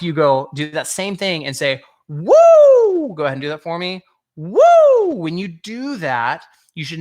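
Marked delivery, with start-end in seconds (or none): 2.1-3.1 high, loud, sustained "woo"; hooting demo of head resonance; head-voice whoop. Shown as a demonstration. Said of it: "moving from your chest register into". 4.4-5.1 high, loud "woo" with a long arc; head-voice whoop. Shown as a demonstration. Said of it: "your head register".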